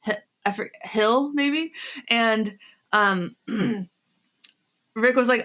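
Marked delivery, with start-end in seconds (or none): none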